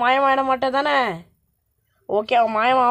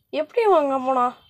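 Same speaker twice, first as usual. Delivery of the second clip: ordinary delivery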